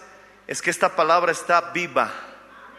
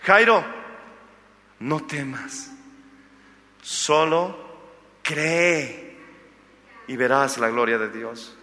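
tender comfort; 5 semitones lower, much slower than usual. Acted. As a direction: hush it down, an embrace in the voice. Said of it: "le abrazó y le dijo".

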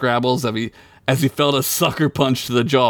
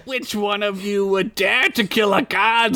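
desperate; 8 semitones higher than usual. Acted. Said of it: "in desperation".

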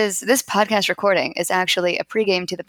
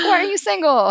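high-pitched mock mother's voice; imitated mom; 11 semitones higher than usual. Imitating mom asking about being single, high mock-mom voice. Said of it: "my mom's like".

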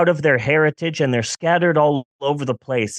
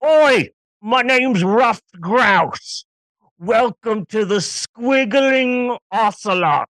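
cheery tavern keeper, slow and loud; loud sassy barmaid calls; 5 semitones higher, slower than usual. Acted. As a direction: greet the customer like a bold barmaid. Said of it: "that's my new character, Ruffed Grouse".

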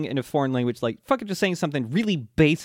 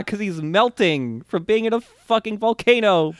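usual speaking voice

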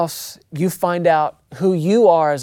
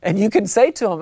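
ordinary talk

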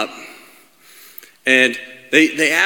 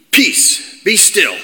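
higher, firm command with a falling end; rebuke; 5 semitones higher, much slower than usual. Acted. Jesus rebukes the wind and sea, firm command. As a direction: command the elements, each phrase set apart. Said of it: "rebuked the wind".

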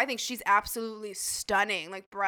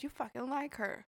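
much softer, under the voice; quiet aside; 9 dB quieter than usual. Retold or imitated. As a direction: say it privately, for one person's ears. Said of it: "like, quietly to me".

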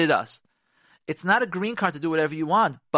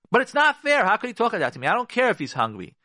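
higher and louder, mock outrage; indignant complaint; 7 semitones higher, 4 dB louder than usual. Wife objecting to her hungry husband, in mock outrage. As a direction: play the wronged wife protesting. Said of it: "wife's indignant complaint".

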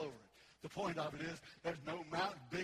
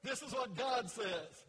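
loud, slow, emphatic scripture proclamation; ringing sermon delivery, calling for amens; full-force preaching; 6 semitones higher, 10 dB louder, slower than usual. Real, not acted. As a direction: preach the promise, leaning on every key word.

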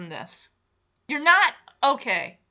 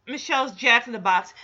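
ordinary delivery

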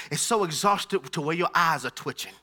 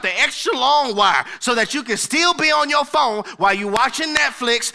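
louder, rising preacher cadence; louder, emphatic sermon delivery; preaching build; 4 semitones higher, 6 dB louder than usual. Real, not acted. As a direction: drive the point home to the congregation. Preaching at full force.

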